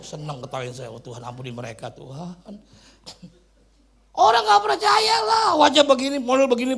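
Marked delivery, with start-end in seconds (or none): 4.1-6.8 very loud, high outburst; comic shouted protest. Real, not acted. Preacher joking to congregation about his looks, comic shouted protest. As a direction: belt out the complaint for laughs.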